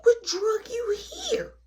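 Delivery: high, slow drawn-out question; stunned disbelief. Retold or imitated. Teen stunned by her grandmother's death, slow high question. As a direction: let the shock stretch the words.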